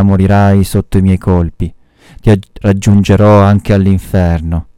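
usual speaking voice

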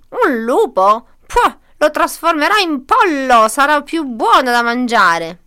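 loud, high-swooping witch voice; horrible grunting witch. A narrator's reading. Witch decides the wolf's fate, loud scornful menace. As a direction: proclaim it loudly as a wicked witch. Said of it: "grugnì".

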